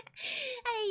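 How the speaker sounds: laughing hello. Real, not acted. Laughing greeting.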